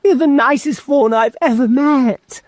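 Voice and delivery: put-on high, reassuring girl voice; a girl consoling a friend; comforting Lucy. A narrator's reading.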